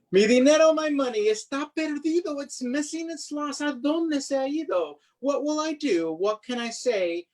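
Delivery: put-on a boy panicking about his lost money; worried boy. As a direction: fret over the lost coins. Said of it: "lost money lament".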